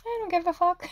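high, airy mock shrug of a voice; sing-song brush-off in a lifted voice; carefree dismissal. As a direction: toss it off way up high, unbothered. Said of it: "you're just like".

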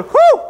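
loud, very high whoop at the heat; high whew. Acted. Imitates men feeling furnace heat.

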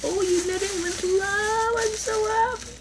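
high, sing-song delight; high gushing joy. Real, not acted. Delighted over knitted hat.

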